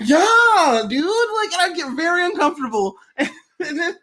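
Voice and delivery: high, laughing voice. Real, not acted. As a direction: tell it while cracking up. Laughing while telling story.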